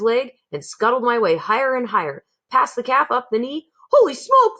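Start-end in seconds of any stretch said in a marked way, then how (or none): 3.9-4.6 very high, loud startled cry; panicked exclamation. A narrator's reading. Cook feels the mouse climbing, alarmed. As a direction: blurt it in alarm. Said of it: "the man said".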